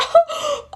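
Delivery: high squealing laugh; giddy squeal-laugh. Retold or imitated. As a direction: squeal with delight. Sister's giddy reaction to chocolate.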